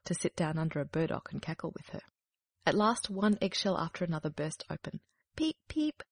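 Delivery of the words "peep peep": high little chick peep. A narrator's reading. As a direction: squeak it like a newly hatched chick. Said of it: "it cried".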